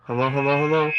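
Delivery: usual speaking voice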